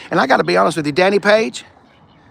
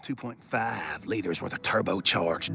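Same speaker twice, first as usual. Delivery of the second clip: usual speaking voice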